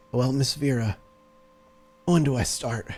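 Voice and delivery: hurt man forcing a jovial tone; wounded man answering his rescuer, cheerfully despite the pain; jovial through pain. Acted. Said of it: "almost with a jovial tone under all that pain".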